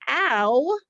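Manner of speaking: high, loud little yelp of ow; mock ow of pain. Shown as a demonstration. Teacher fakes pain to stress the ow sound. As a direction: yelp ow like you got poked. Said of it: "Notice the ow".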